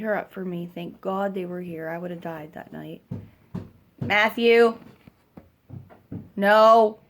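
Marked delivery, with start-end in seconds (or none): 4.0-7.0 loud scolding shout; calling out. Real, not acted. Mother breaking off to stop Matthew, loud shout. Calls out sharply to child, real.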